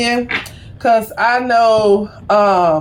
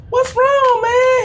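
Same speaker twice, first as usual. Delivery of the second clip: 1 concerned; 13 semitones higher, slower than usual. Retold or imitated. Asking grumpy clerk what's wrong.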